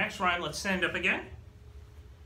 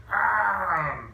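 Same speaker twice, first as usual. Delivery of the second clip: mock dinosaur roar; 2 semitones higher, 6 dB louder than usual. Librarian roaring as a T. rex for the kids, playful.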